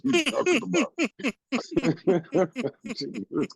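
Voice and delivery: high breaking into laughter; laughing. Real, not acted. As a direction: laugh through the words.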